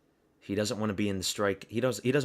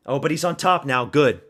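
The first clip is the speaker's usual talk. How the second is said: excited; 4 semitones higher, 9 dB louder than usual. Real, not acted. Fighter takes top position.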